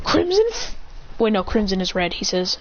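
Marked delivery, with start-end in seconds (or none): 0.0-0.8 high-pitched move name, cut off; attack call. Acted. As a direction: start calling a special attack, pitched up. Calls out attack name, breaks off.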